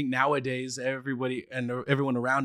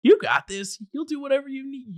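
high, louder cheer of support; encouraging parents; 12 semitones higher, 5 dB louder than usual. Retold or imitated. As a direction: pump up the kid like a proud parent. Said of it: "just be like".

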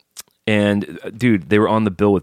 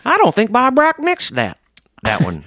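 hillbilly voice; 3 dB louder than usual. Pretend. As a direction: play a backwoods old-timer, pitched up. Hillbilly voice bit.

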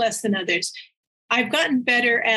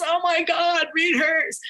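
excited urging; 6 semitones higher, slower than usual. Retold or imitated.

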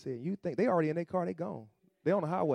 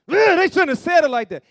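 high, loud, whiny indignant protest; indignant complaint; 14 semitones higher, 17 dB louder than usual. Retold or imitated. Believer sulking about a rebuke, high whiny indignation. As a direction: whine like someone offended by the tone. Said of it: "And you still".

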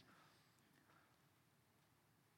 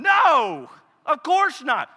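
emphatic loud no; 10 semitones higher, 9 dB louder, much slower than usual. Acted. Preacher answering emphatically.

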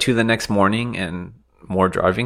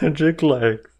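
higher mock movie voice; Forrest Gump quote; 7 semitones higher, slower than usual. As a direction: deliver it like the film line.

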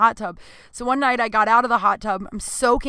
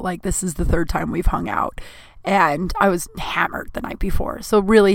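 ordinary delivery

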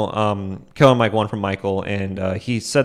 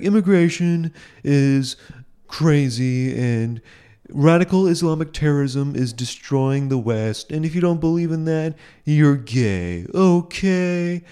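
higher, slow mock pundit voice with long pauses; mocking Bill Maher; 6 semitones higher, slower than usual. Vlogger mocking Bill Maher's monologue, higher hammed-up voice. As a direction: ham up a smug TV host's monologue. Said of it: "be like".

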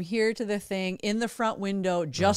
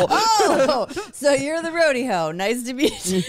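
mock family greeting; 5 semitones higher, slower than usual.

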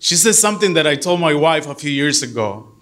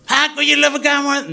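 mock indignant wife; 10 semitones higher than usual. Wife's indignant retort.

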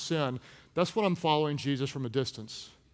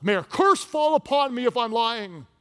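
high, loud acted swearing; vehement oath; 6 semitones higher, 5 dB louder than usual. Peter denying Jesus a third time, vehement oath. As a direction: swear it with raised voice.

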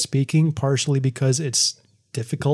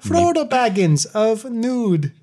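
mock grand introduction; 8 semitones higher, slower than usual. Mock announcing a fantasy title.

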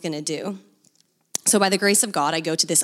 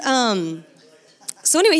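normal talking voice

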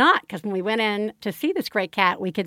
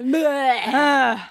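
grotesque monster voice. Acted. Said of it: "That's your monster voice".

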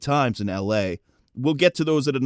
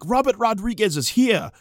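higher-pitched imitated Japanese accent; 8 semitones higher than usual. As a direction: announce it in a mock Japanese accent. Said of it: "like, accent, can barely speak English".

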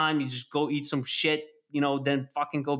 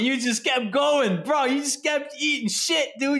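laughing; 8 semitones higher than usual. Real, not acted. Mocking the lockdown slump.

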